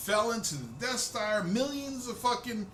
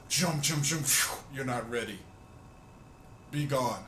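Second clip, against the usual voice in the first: mock wise master, a touch lower, waving them off; Luke's sage brush-off; 7 semitones lower than usual. Comedian dreaming of Luke saving Rey and Finn, lower calm master voice. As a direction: imitate Luke Skywalker brushing off his foes with ease. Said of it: "I wanted Luke to show up and go".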